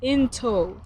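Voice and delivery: INTO as a separate piece with a full O; clear O. Shown as a demonstration. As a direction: sound out "into" for a learner, stressing the O. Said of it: "There's an O".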